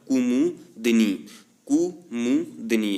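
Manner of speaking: कुमुदनी drawn out very slowly, each syllable clear; teacher slowly modelling कुमुदनी; slow careful pronunciation. Shown as a demonstration. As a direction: say कुमुदनी slowly with a short u then long u. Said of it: "छोटी यू और बड़ा ऊ की विशेष तौर पर ध्यान देना".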